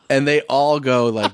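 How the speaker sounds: usual speaking voice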